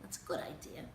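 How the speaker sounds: plain reading voice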